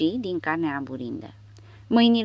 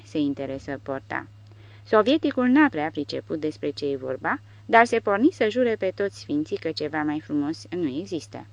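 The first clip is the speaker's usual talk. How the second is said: plain reading voice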